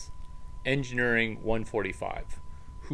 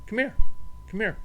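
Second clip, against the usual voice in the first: calling the dog; 9 semitones higher, 6 dB louder, much faster than usual. Real, not acted.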